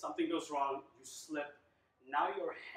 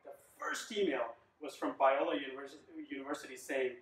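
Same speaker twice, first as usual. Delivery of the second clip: ordinary talk, nothing marked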